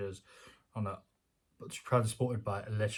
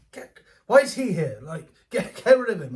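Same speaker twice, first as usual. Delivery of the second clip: much higher, louder exasperated yell; exasperated shout; 6 semitones higher, 10 dB louder than usual. Retold or imitated. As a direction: shout to throw him out. Voicing the crowd ejecting the crank.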